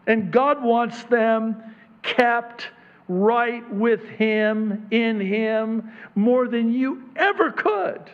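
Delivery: full-force sermon voice; fervent preaching. Acted. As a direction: drive it home to the congregation.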